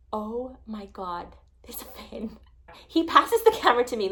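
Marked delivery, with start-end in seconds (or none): 0.1-2.4 slow, soft, breathless whisper; Dan's slow, hushed whisper when he spots the fin. Retold or imitated. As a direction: hush it so the whale isn't startled. Dan quietly spots a fin.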